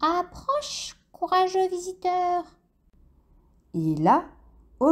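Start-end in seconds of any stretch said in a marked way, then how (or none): 0.0-2.4 high, eerie cave voice; a hollow voice calling from the dark; cavernous voice. A narrator's reading. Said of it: "une voix caverneuse se fit entendre".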